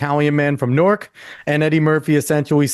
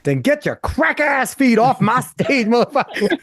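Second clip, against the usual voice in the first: Dave Chappelle's way; 9 semitones higher than usual. Retold or imitated. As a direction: drag it out, half laughing, like Chappelle. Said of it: "in Dave's way".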